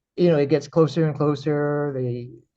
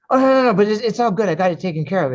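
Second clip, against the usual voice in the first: hasty reassurance; 8 semitones higher, 6 dB louder, faster than usual. Retold or imitated. Acted reassuring of alarmed wife.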